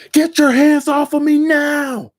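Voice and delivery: very high, loud, slow screamed demand. Retold or imitated. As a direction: shriek it as if grabbed. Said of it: "And he screams".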